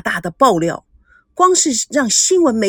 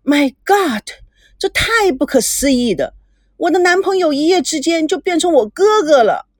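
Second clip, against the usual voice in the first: put-on shocked girl, pitched up; disbelief; 6 semitones higher than usual. A narrator's reading. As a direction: cry out that she can't believe it. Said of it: "无法相信".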